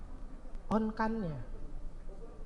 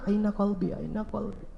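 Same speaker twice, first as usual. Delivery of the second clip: soft lament; 3 semitones lower, 11 dB quieter than usual. Acted. Youth softly lamenting.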